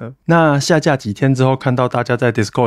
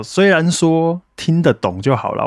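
usual speaking voice

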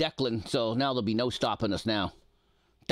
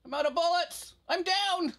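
urgent. Real, not acted. Desperate mid-fight callout.

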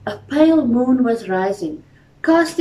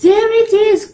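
much higher, louder joyful cry; excited cry; 12 semitones higher, 7 dB louder than usual. A narrator's reading. Boy sees his lost motorboat found, excited cry. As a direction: burst out with delight. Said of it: "cried Harry".

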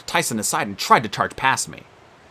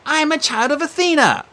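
insistent; 3 dB louder than usual. Acted. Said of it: "she insisted".

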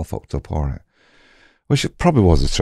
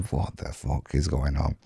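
slow, much softer disbelieving curse; hushed panic; 5 semitones lower, 12 dB quieter, slower than usual. Retold or imitated. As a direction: mutter it slowly, stunned and low. Panicked aside to himself.

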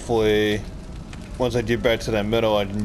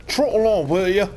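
lilting mock Cockney, pitched up; Cockney British accent; 6 semitones higher than usual. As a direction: echo the line in hammed-up Cockney. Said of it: "My Cockney British accent is phenomenal".

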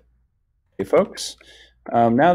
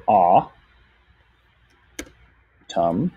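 AH, a long pause, then TUM; AH and TUM as isolated syllables; 3 semitones lower than usual. Shown as a demonstration. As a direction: break it into AH... TUM. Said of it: "so that's two syllables".

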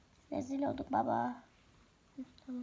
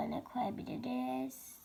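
whisper; 7 dB quieter, slower than usual. Acted. Pondering a name, hushed.

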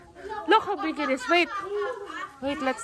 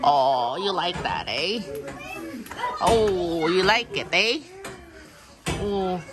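low cooing pet talk; 10 semitones lower, slower than usual. Real, not acted. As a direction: murmur low to a horse being scratched. Petting horse, low cooing.

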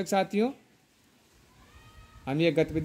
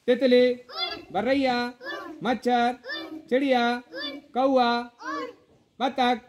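calling out; 6 semitones higher than usual. Real, not acted. Teacher calls out game words.

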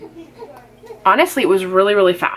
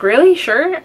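high, loud surprised questioning; a startled double question; 8 semitones higher, 4 dB louder, slower than usual. Retold or imitated. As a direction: pitch it up in disbelief, quick retold reply. Retelling her surprised reply to the nurse.

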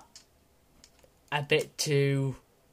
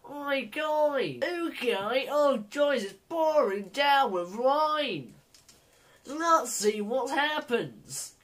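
higher Joey accent with a mock cockney "oi"; Joey accent, singsong and cheeky; 7 semitones higher than usual. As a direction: do your mate Joey's accent, cheeky. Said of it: "My Joey im-, accent".

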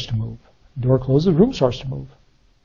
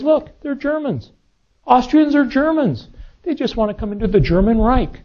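Hitler; 6 semitones higher than usual. Retold or imitated. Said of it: "Well, Hitler says".

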